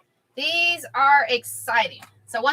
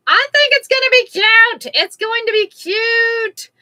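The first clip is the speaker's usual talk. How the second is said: excited; 10 semitones higher, 9 dB louder, slower than usual. Real, not acted. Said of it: "rising excited squeal".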